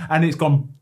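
usual speaking voice

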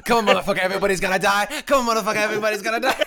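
high, loud manic battle cry; hammed-up berserk threat; crazed cricket. Co-host playing the attacking cricket, crazed and shouting.

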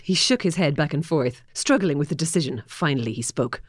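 normal talking voice

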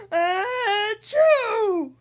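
loud, high hammed-up achoo; a huge bear sneeze from the pepper; big sneeze. Bear sneezing from a pepper fleck, loud hammed-up sneeze. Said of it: "He blows and he sneezes".

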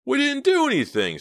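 high, loud angry snarl. A narrator's reading. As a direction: snarl the denial in anger. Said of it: "snarled Murphy".

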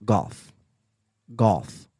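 "golf" repeated as a careful specimen; pronunciation demo. Shown as a demonstration. Testing the word for chat, "golf" said carefully. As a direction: say "golf" as an isolated test word. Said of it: "the way you pronounce golf".

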